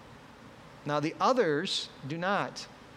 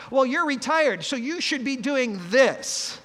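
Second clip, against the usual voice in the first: bossy advice; 8 semitones higher, 6 dB louder than usual. Retold or imitated. Mocking a busybody's advice.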